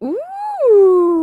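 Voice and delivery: high excited gasp and ooh; gasp, then a delighted ooh; surprised gasp. Real, not acted. Spots something exciting.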